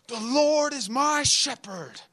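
high, slow, proclaiming the psalm; hyped young preacher. Retold or imitated. Young preacher at the pulpit performing for the crowd, hyped and high. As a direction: belt it like a preacher chasing applause.

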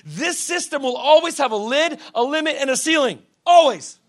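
high, loud preacher's delivery; full-force preaching. Real, not acted. Preacher warning the congregation about the world's system, full-force preaching. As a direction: hammer each point home to the congregation.

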